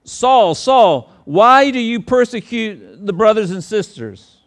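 loud, high, slow call from heaven; preacher voicing Jesus, high and loud. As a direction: boom it out as the risen Lord calling Saul. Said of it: "and he says".

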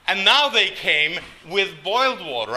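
normal talking voice

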